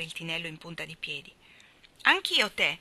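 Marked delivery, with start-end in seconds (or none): 2.0-2.8 put-on high, loud little-child voice; small boy demanding tea. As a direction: pipe up like an eager toddler. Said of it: "disse Demi".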